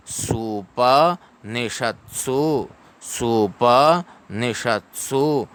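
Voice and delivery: "सुपनिषत्सु" broken into soo-pa, ni-shat, soo; slow pronunciation model. Shown as a demonstration. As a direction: draw out "सुपनिषत्सु" in separate chunks. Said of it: "बोलिए वापस पुनः उच्चारण करें".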